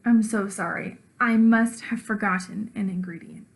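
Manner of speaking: plain reading voice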